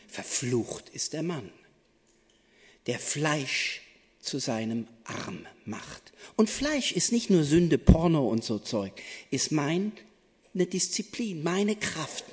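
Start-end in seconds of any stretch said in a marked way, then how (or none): 0.1-6.1 stern prophet. Acted. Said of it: "Jeremia sagt sehr streng".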